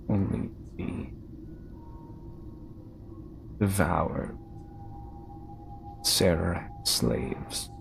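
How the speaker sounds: slow, lightly voiced ancient guardian; ominous statue voice. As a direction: let the statue's words sink in slowly.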